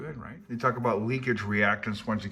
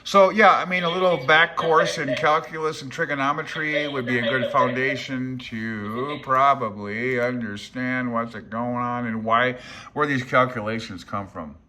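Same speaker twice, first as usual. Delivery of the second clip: louder, higher lecturing voice; hammed-up lecture; mock professor; 3 semitones higher, 6 dB louder than usual. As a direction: play a pompous teacher.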